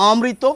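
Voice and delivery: অমৃত as o-mri-to, one m; single-m pronunciation. Shown as a demonstration. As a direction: say অমৃত with the m not doubled. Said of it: "এর আগে যদি অ লাগিয়ে দিই".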